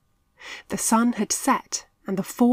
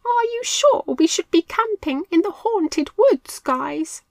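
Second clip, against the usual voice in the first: much higher, squeaky frightened mouse; trembling; 11 semitones higher, 6 dB louder than usual. A narrator's reading. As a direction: quaver with worry. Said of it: "trembled Milo".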